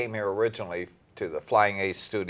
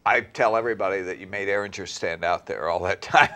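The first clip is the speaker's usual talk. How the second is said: usual speaking voice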